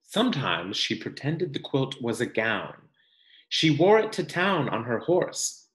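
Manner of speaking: gentle, expressive picture-book reading; reading to children. Acted. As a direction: read it like a storybook for the class.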